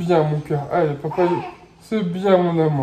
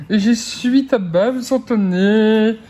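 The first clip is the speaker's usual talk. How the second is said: normal talking voice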